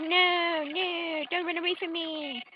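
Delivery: high, wailing character voice; mock desperate plea. Clara begs her not to run away, high mock wail. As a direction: beg in an exaggerated high wail.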